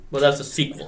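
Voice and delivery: ordinary talk, nothing marked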